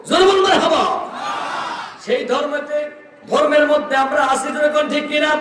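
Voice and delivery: high, shouted sermon call; rally the congregation at a high pitch; fervent preaching. Real, not acted. Preacher asking the crowd to affirm the one religion, fervent high preaching.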